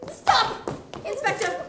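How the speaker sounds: loud shout to stop them. Acted. Stopping the inspector from taking them away, loud shout. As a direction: call out sharply to halt the arrest. Calling out to halt arrest.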